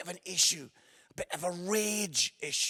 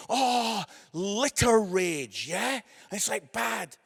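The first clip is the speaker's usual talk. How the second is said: normal talking voice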